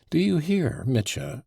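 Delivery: high, pressing question; insistent. A narrator's reading. Grushenka pressing Mitya to listen, insistent. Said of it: "she went on insistently".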